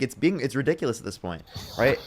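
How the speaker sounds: ordinary talk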